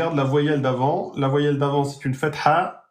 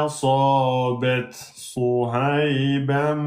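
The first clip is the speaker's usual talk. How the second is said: Quranic recitation; slower than usual. Acted. Teacher recites the full sentence for students, slow chanting. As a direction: recite slowly with held vowels.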